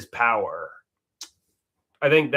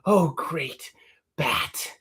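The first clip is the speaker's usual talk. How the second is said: awed breathy whisper of a madman; 2 semitones higher, much slower than usual. Pretend. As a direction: whisper in reverent awe. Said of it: "can you do a line or a scene".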